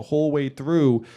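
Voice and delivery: the last push of a stump speech; rousing closer. Real, not acted. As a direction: land the vote ask big. Closing rally line to the voters.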